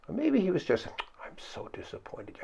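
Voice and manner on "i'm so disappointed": softer, breathy-free hushed sigh of disappointment; a mild sigh of letdown; disappointed. Retold or imitated. Jesus quietly letting his disciples know he's disappointed. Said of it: "I don't think that was it".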